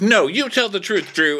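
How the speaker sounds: high-pitched acted protest; mock indignant outburst. Cast member confronting Drew at the reunion, mock indignant. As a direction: play up the indignant retort.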